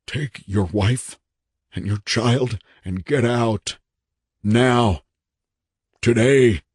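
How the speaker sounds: wild, desperate order. A narrator's reading. Wild-eyed man orders visitor out.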